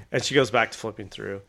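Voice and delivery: normal talking voice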